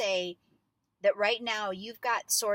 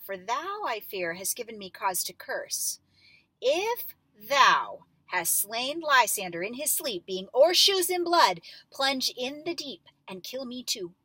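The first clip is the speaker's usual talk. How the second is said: figuring it out, building; 4 semitones higher, 6 dB louder than usual. Shown as a demonstration. Building from dawning suspicion to accusation. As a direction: pitch climbing into an almost-shouted accusation. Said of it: "kind of figuring it out".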